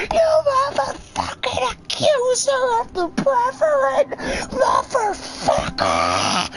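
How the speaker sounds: the woman screams back at the man who accused her; mock ranting zealot. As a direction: rave like a fire-and-brimstone zealot.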